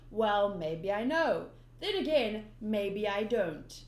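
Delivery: slow, teasing villain drawl; sly Buggy. Acted. Buggy the clown teasing Luffy, sly and slow. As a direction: toy with him, smug and slow. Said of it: "Buggy's being all sly".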